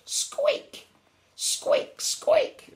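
very high, sharp squeaks; mouse squeaks. A narrator's reading. Mouse tugging the lion along, very high squeaking. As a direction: squeak each word separately.

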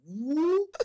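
high squeaky sitcom whoop; mock cartoon panic whoop; That's So Raven whoop. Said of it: "I had a That's So Ravens moment".

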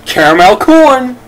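high, loud and slow with relief; excited relief. Real, not acted. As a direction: cheer it out, drawn out. Relieved after tasting the bean.

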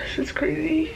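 sigh, then a shaken whisper; emotional sigh and whisper. Real, not acted. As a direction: let the sigh carry into a hushed line. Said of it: "I'm so emotional".

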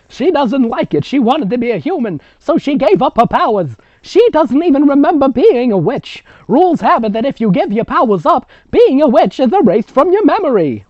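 high, loud storytelling grandmother. Acted. Grandmother explaining witch rules to the twins, high and loud.

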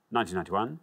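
ordinary talk, nothing marked

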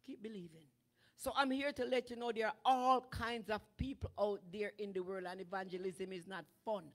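ordinary delivery

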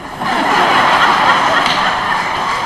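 real burst of helpless laughter; a big open laugh that runs out of breath; loud breathy laugh. Real, not acted. Laughing out loud at the stage memory, a big breathy burst.

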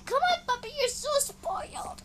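put-on very high, soft baby talk; cooing pet talk. Player talking to game puppy, high-pitched cooing.

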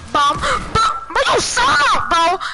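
high, loud and slow mocking yell; long loud sing-song taunt; drawn out jeer. Real, not acted. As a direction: stretch the insult out to rub it in. Taunting opponent in game.

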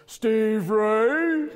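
God summoning from the throne; thunderous God voice. Acted. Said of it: "God's thunderous voice".

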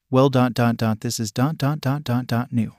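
ordinary delivery, nothing marked

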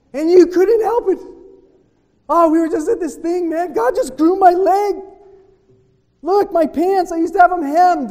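high, loud, bursting with excitement; loud excited testimony about a miracle; excited retelling. Retold or imitated. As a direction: blurt out the miracle to strangers. Excited testimony after the meeting.

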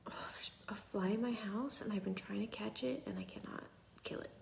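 hushed whisper so the napping son stays asleep; breathy whisper, barely voiced. Real, not acted. Whispering to viewers while her son naps. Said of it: "Sorry if I'm whispering a little".

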